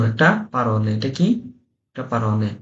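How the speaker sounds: ordinary delivery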